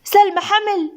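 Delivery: high-pitched happy exclamation; delighted news reaction; overjoyed. A narrator's reading. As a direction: burst out with joyful surprise, voice lifted high. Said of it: "عالية بسعادة كبيرة".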